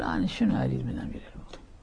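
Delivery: fast whispered dismissive line; whispered mock reply. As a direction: mutter it off quickly under the breath. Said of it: "يقول".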